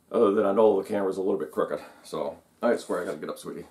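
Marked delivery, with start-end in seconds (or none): 2.8-3.7 quiet, low, talking to a cat; soft to a pet. Real, not acted. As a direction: gently coax the cat off your lap.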